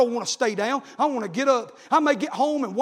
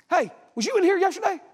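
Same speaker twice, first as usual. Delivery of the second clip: tag; 7 semitones higher than usual. Retold or imitated. Nurse calls after departing preacher.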